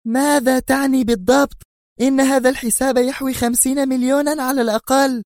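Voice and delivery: high outraged exclamation; angry cry. A narrator's reading. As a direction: demand an answer in anger. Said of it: "قبل أن تهتف في غضب".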